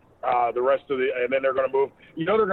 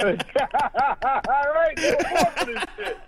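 laughing; 10 semitones higher, much slower than usual. Real, not acted. Laughing at cohost's Panera joke.

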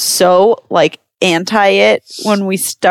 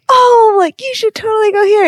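very high, gushing mock excitement; enthusiastic recommendation; 3 dB louder than usual. Hosts recommend places to visitors, hammed-up enthusiasm. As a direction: burst in high on the "Oh", imitating eager locals.

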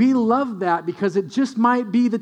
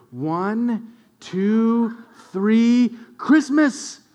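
building countdown; 2 semitones higher, much slower than usual. Shown as a demonstration. Dad teaching his toddler to switch on the tree, building countdown. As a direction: build the count to a happy burst. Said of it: "We go".

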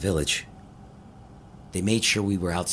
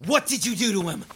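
angry demand; 13 semitones higher than usual. Acted. Confronting captors about Colin.

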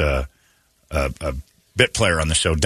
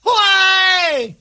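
wailing gardener; much slower than usual. Pretend. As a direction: sob out in despair.

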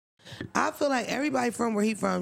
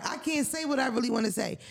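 ordinary talk

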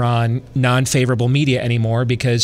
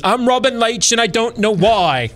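high, showy British TV host voice; Robin Leach impression; 9 semitones higher than usual. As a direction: do Robin Leach, pitched up and grand. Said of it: "then I can do, like, Robin Leach".

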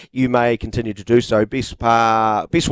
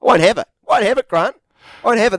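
mock indignant; 8 semitones higher, 3 dB louder, faster than usual. Defending his southern roots.

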